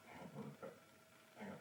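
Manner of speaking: whispering hang on to himself; unvoiced murmur to himself; whispered aside. Real, not acted. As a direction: breathe it under the voice. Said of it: "I'll do it in voice".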